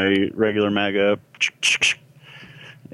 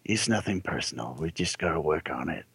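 quiet, consoling words to a blade; soft murmur to sword; 3 semitones lower, 9 dB quieter than usual. Acted. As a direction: murmur it gently to your sword. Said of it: "I say to my katana".

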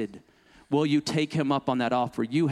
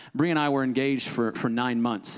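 ordinary talk

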